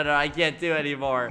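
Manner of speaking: normal talking voice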